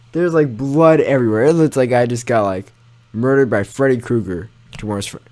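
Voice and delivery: ordinary talk, nothing marked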